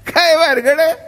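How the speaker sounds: high puzzled question. Retold or imitated. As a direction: ask in surprise, rising high. Puzzled husband asks.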